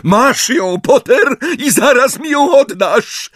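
high, loud insistence; high-pitched desperate yell; panicked demand. A narrator's reading. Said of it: "słychać było wyraźnie panikę".

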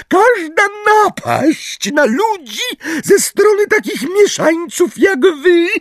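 very high, squeaky officious voice; Umbridge. A narrator's reading. Umbridge warns the centaurs by law, squeaky. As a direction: squeal out the warning, drawing out key words. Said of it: "jeszcze bardziej piskliwym głosem".

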